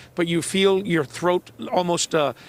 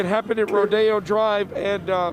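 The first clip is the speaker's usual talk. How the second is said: teary from tear gas; 4 semitones higher than usual. Real, not acted. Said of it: "my eyes are watering and I can barely".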